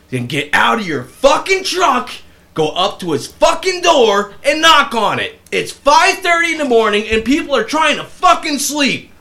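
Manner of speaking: yelling high and loud, slow, spitting each curse; angry neighbor roaring at a horn-blowing driver; furious shout. Retold or imitated. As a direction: tear into the driver at full volume.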